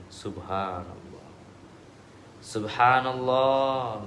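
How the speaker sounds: Subhanallah drawn out slowly and calmly. Shown as a demonstration. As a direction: answer the tempter slowly, unbothered. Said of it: "Ya, gimana jawabnya?".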